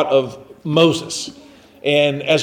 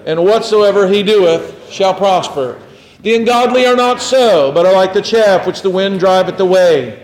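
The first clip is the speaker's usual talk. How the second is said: raised, ringing psalm recitation, easing toward the end; scripture recitation; 4 semitones higher, 3 dB louder than usual. Acted. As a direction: declaim the psalm for all to join.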